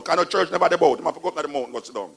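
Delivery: usual speaking voice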